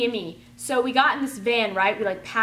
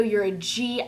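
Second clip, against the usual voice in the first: normal talking voice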